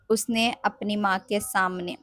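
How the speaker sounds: usual speaking voice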